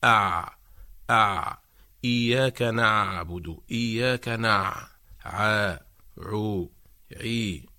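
ع pressed from mid-throat, repeated; syllable drill on the letter ع; throat ain drill. Shown as a demonstration. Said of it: "فنقول".

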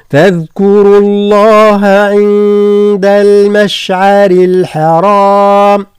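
slow, drawn-out tajweed chant; Quran recitation. Shown as a demonstration. Teacher recites the verse for students, slow chant. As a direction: recite it long and steady.

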